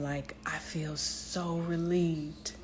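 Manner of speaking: soft whisper of relief after a good cry. Real, not acted. Telling viewers how crying felt, in a soft whisper. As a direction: breathe it out in a hushed whisper.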